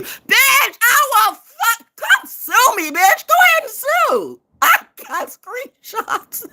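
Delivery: high, loud yelling at haters; furious shout. Real, not acted. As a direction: scream it at them, all the way up. Shouting at her online haters.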